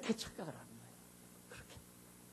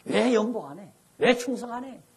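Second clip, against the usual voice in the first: louder mock rebuke of a deacon; scolding pastor; 5 semitones higher, 9 dB louder than usual. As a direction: scold the absent deacon from the pulpit. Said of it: "이러고 이제 질책하고". (집사를 꾸짖는 목사의 호통)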